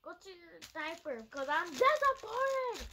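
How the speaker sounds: playful outraged scolding; mock scolding. Real, not acted. Scolding the ruined popsicle.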